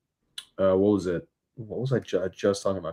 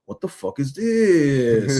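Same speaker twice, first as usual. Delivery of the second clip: slow, drawn-out exasperated "What the fuck is this?"; stretched-out annoyed question; annoyed catchphrase; 5 semitones higher, 5 dB louder, much slower than usual. Retold or imitated. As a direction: drag out "What the fuck is this?" in mock disgust. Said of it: "in the background doing the".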